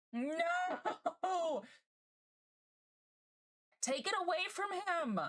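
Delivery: high, drawn-out laughing protest; mock horror. Real, not acted. Streamer reacting to a man dousing himself in Axe, mock-horrified wail. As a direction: wail it in playful dismay.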